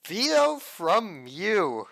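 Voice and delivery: slow, stretched-out repeat; slow drawn-out. Real, not acted. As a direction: drag each word out.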